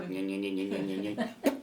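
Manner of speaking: low buzzing imitation of an electric can opener; can opener noise. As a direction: buzz it low like the motor grinding. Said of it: "It's an electric can opener".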